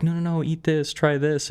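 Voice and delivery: insistent grandma. Retold or imitated. Grandma urging a guest to eat at her table, insistent and high. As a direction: lightly act a grandma pressing food on a guest.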